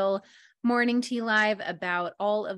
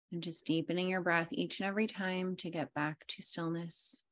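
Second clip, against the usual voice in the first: guided meditation; 3 semitones lower than usual. Acted. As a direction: lull them into stillness, fading low. Leads breathing meditation.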